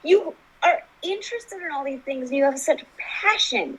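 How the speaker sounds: high, slow, emphatic affirmations; encouraging therapist. Retold or imitated. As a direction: voice a therapist building up a client. Voicing therapist's uplifting speech.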